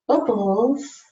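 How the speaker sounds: usual speaking voice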